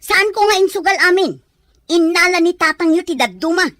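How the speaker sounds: loud, high protest; angry denial. Acted. Husband denying he gambled all the money, loud angry protest. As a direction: fire back defensively.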